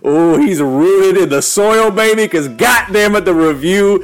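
high, loud hype-man intro; grand entrance announcement. Acted. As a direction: announce it like a boxing-ring entrance. Hype opening of the live show.

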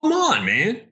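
high-pitched plea of disbelief; exasperated protest. Real, not acted. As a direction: appeal to the referee, exasperated. Still protesting the late hit.

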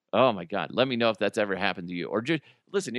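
ordinary talk

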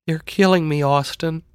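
put-on high-pitched, troubled girl's voice; high, urgent plea; pleading sister. A narrator's reading. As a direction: plead with your brother for help. Roused sister pleads with brother.

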